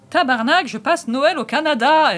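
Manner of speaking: loud, high mock Québécois accent. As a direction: belt the title out in exaggerated Québécois. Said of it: "tu peux me refaire l'accent québécois".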